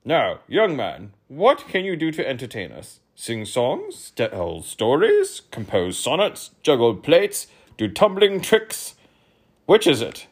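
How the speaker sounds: demanding king; pompous king. Acted. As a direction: quiz the boy grandly. King voice held.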